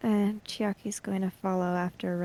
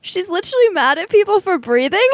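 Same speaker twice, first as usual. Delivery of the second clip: incredulous outburst; 9 semitones higher, 9 dB louder, faster than usual. Real, not acted. Player laughing at absurd scene.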